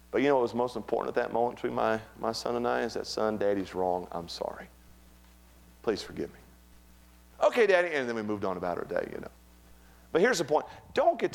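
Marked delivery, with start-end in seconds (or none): 3.1-6.4 low, soft, slowing apology; sincere apology. Retold or imitated. Father apologising to his young son, low and tender. 7.4-7.9 put-on higher, easy little-boy reply; lightly voiced child. Son accepting his father's apology, lightly childlike. Acts out young son's reply.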